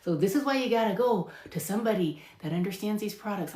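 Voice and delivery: ordinary talk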